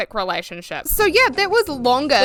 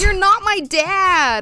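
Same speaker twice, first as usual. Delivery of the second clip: bratty retort; 10 semitones higher, 4 dB louder, much slower than usual. Acted. Acted quarrel with boyfriend.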